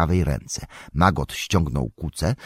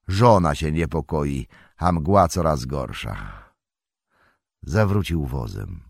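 put-on louder, higher, hearty old farmer; farmer Maggot; 4 semitones higher, 6 dB louder than usual. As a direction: speak bluff and good-natured. (farmer Magot: donośny, rubaszny, dobroduszny głos)

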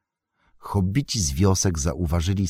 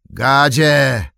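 loud, higher summoning call; 8 semitones higher, 8 dB louder than usual. Acted. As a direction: bark for his servant. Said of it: "zawołał Saruman".

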